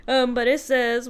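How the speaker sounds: usual speaking voice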